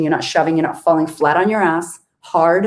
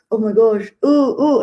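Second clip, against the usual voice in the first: mock nervous; 5 semitones higher than usual. Acting out nervous excitement.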